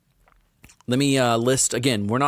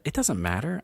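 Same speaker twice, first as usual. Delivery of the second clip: usual speaking voice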